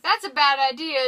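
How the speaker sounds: mock objection. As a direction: object loudly, imitating a movie hero. Cap objects in retold argument.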